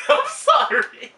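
very high, squealed slow sorry; laughing apology. Real, not acted.

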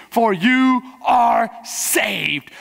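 drawn-out shout of for you are saved; slow shout. Real, not acted. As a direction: proclaim it slowly at full voice. Preacher shouts climax.

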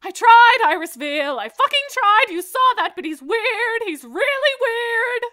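high, loud frustrated yell; shouting. Acted. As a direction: scream it like you mean it. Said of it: "Now you're singing".

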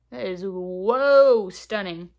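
stopped short by how pretty it is; amazed whoa. Real, not acted. Seeing the eyeshadow shade on camera, drawn-out amazed whoa.

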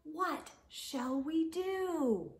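usual speaking voice

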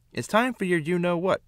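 a high, sing-song hint of mum; teasing mother. Mother's quote in story.

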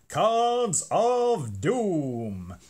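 slow, booming game show announcer. Pretend. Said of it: "Best game show voice there".

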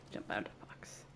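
mock secret whisper answering himself. Playing a game, whispering the echo back to himself. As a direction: hush it like a conspirator.

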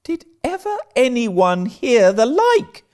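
high, scandalised outburst; amazed exclamation. Acted. Marilla hearing Anne's ghost stories, astounded. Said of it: "Ejaculated Marilla".

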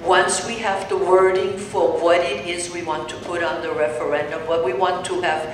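usual speaking voice

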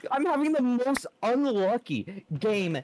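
high, strained complaint; exasperated lament. Real, not acted. Player lamenting an unlucky game, exasperated lament. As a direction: whine loudly in disbelief.

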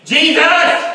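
very high, loud desperate cry; calling out. Retold or imitated. Beggar calling louder.

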